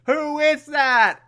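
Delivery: high, slow, singsong mock query; Batman. Batman asks who is behind the door, hammed-up call. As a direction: call it out with exaggerated innocence. Acted Batman calls to unseen Alfred.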